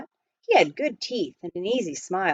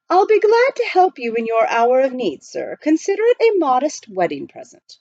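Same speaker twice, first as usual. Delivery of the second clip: high, precise butler voice; proper butler; 6 semitones higher, 4 dB louder than usual. Acted. Butler explaining to the miner, politely proper. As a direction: speak like a correct English butler.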